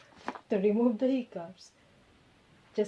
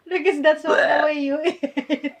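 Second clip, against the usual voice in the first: laughing; 6 semitones higher, 8 dB louder than usual. Real, not acted. Laughing at hiccuping friend.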